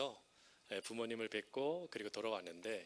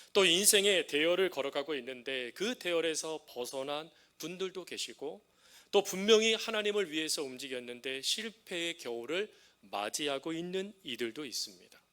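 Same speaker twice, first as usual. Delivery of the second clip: rising preaching; 3 semitones higher, 5 dB louder than usual. Acted. Pastor exhorting his congregation, rising preaching. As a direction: build the sermon toward its climax.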